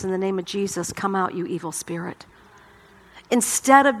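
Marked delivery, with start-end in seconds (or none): 0.0-2.3 softer, calm rebuke with no harshness; a quiet, kind rebuke of an evil spirit; gentle command. Acted. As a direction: cast it out gently, like a loving friend. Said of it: "with the eyes and the arms of Jesus".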